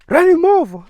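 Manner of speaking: high, loud yell; acted shout. Clarence's friend in the movie, shouting an order. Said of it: "Clarence's friend was like".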